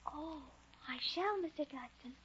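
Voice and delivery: very soft, hushed assent; solemn promise. Acted. Child promising Mister Dodson she shall, hushed and solemn. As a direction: promise quietly and earnestly.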